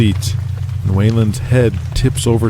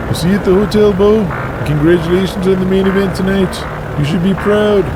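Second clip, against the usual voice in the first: higher, friendly wrestler voice; Waylon voice; 11 semitones higher than usual. Acted. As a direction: cheer him on, pitched up.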